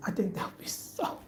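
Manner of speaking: breathy hushed whisper, building suspense. Real, not acted. To viewers about satin pillowcases, whispered. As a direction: drop to a whisper as the idea lands.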